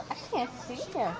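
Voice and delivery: ordinary delivery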